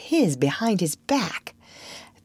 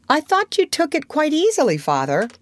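put-on much higher, puzzled little girl; innocently puzzled question; 11 semitones higher, 3 dB louder than usual. A narrator's reading. Wendy puzzled.

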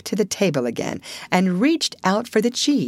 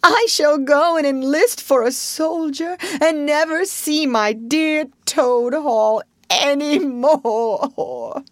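high, wailing, tearful lament; crying; 10 semitones higher, 4 dB louder, slower than usual. A narrator's reading. Toad mourns Toad Hall on the sofa, crying. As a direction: wail it out, dragging the last words. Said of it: "crying into the sofa cushions".

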